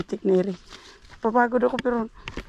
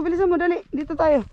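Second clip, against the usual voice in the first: while running; 8 semitones higher than usual. Real, not acted. Urging dog while running.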